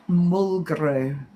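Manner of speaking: slow, drawn-out Cornish-sounding word. Shown as a demonstration. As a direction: draw it out with a hint of Cornish. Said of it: "Sounds Cornish".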